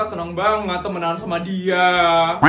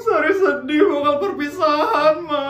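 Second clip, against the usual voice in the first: crying; 9 semitones higher than usual. Acted. Sari sobs about graduation farewell.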